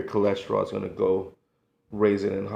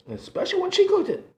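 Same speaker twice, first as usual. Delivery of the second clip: excited; 5 dB louder, faster than usual. Real, not acted. Gushing over grandmother's cooking.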